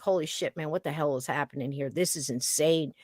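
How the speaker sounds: low, fast, under the breath; stunned muttering. Retold or imitated. Inside the stranger's car, muttering in quiet disbelief. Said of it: "I was like".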